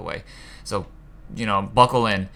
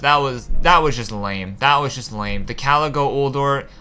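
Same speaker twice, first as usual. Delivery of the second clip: usual speaking voice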